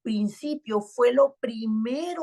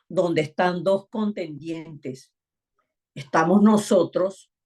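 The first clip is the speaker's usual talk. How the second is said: ordinary talk, nothing marked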